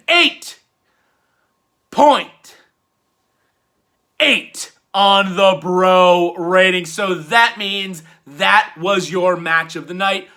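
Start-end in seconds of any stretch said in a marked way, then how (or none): 0.1-6.9 louder, slow and drawn-out score reveal; announcer score call. Pretend. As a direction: stretch it like a ring announcer.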